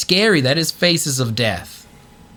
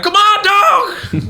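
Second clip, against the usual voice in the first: much higher, louder, slow whining complaint; exasperated plea; 4 dB louder, slower than usual. Retold or imitated. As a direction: plead with God like a frustrated buddy. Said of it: "He's like".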